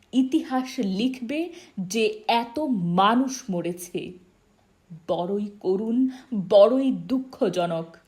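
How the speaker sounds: loud, ringing denunciation; impassioned protest speech. Acted. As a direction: address the whole march in outrage. Friend's speech at a protest march.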